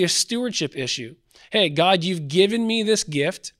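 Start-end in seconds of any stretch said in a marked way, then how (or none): none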